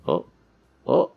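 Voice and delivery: ordinary talk, nothing marked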